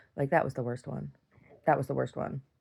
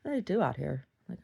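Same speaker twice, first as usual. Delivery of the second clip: usual speaking voice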